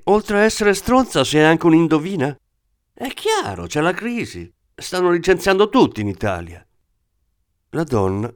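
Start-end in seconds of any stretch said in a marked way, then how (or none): 0.0-6.7 much higher, sarcastic, riled-up voice; a jumpy thief answering back; the thief sneering at the woman's guess; agitated thief. Acted. Thief scoffs and retorts.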